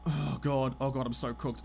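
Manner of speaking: low, soft groan of defeat; despairing mutter. Real, not acted. Streamer facing the horde, soft defeated groan. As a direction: sink into quiet despair.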